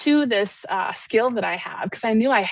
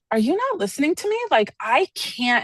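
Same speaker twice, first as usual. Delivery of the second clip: retold exasperation, higher and fast, then slowing on "I can't"; exasperated at the therapist who isn't listening, pitched up; frustrated; 6 semitones higher than usual. Telling her mentor he isn't hearing her, frustrated. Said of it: "I was kind of frustrated".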